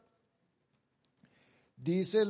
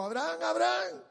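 high, louder call from afar; 9 semitones higher, 4 dB louder, faster than usual. Acted. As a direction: call his name down from heaven. Angel calls from heaven.

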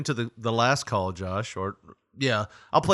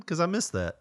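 normal talking voice

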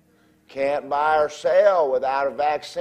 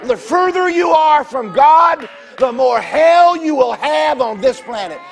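loud, high, slow sermon shout; full-force preaching; 9 semitones higher, 9 dB louder, slower than usual. Real, not acted. To the congregation, loud preaching about God and hell. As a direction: drive the warning home to the congregation.

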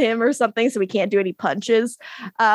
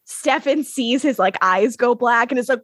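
ordinary talk, nothing marked

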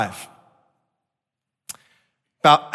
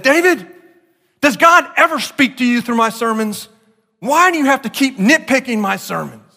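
frustrated confrontation; 7 semitones higher, 3 dB louder than usual. Retold or imitated. Pastor confronting critic after service.

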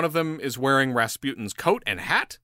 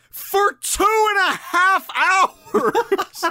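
high, loud, long-drawn yell; incredulous shout; 6 dB louder, much slower than usual. Real, not acted. Storyteller on how long he kept eating, incredulous yell. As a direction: stretch every word into an outraged comic shout.

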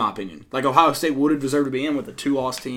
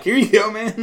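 higher, louder, casually impatient; mock player handing off ball; 9 semitones higher, 6 dB louder than usual. Player retelling a ref who wouldn't take the ball, light mock. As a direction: toss it off like it's obvious. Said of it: "I was just like".

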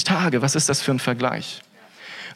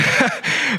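laugh; 10 semitones higher than usual. Real, not acted. Amused at Gideon's disbelief.

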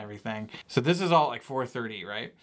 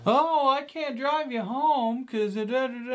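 mocking tow driver; 12 semitones higher, 4 dB louder than usual. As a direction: drag out the lame excuse in a silly high voice. Tow driver excuse, mocked.

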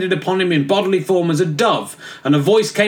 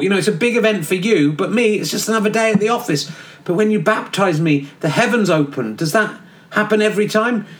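cheeky geezer John; 3 semitones higher than usual. Acted. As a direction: play John as a careless lad in a cell. John the Baptist as bloke.

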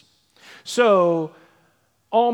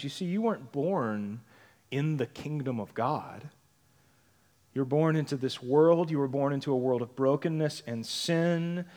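ordinary delivery

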